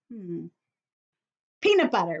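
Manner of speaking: ordinary talk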